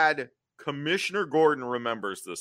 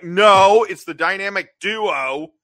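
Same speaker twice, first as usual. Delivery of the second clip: loud, offended correction; indignant correction; 8 semitones higher, 10 dB louder, slower than usual. Retold or imitated. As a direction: snap back loudly, offended. Characters indignantly correct the name.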